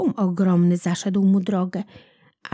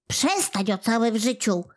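indignant; 2 semitones higher than usual. A narrator's reading. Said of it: "żachnął się Kłobuk".

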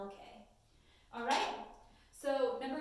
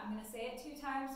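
ordinary delivery

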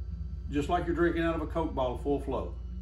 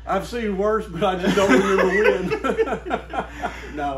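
louder, slow teasing through laughter; laughing; 4 semitones higher, 8 dB louder than usual. Real, not acted. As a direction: rib him while laughing. Teasing friend about old calling.

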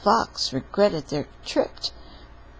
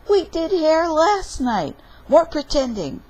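put-on high, loud storybook kid protest; child's protest; 10 semitones higher, 5 dB louder than usual. Little fox refusing hair wash, high protest.

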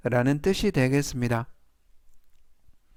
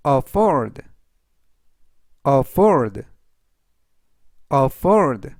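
firm, louder vocabulary drill; slow model reading; 6 dB louder, much slower than usual. Shown as a demonstration. Teacher reviewing words for learners to repeat, slow firm reading. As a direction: read it three times with clear gaps to repeat. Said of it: "제가 천천히 세 번씩 읽어드리고요".

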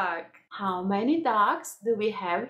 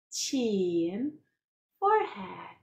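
ordinary talk

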